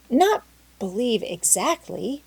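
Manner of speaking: falter, losing confidence mid-phrase; a scolded girl backing down timidly. A narrator's reading. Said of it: "faltered Anne".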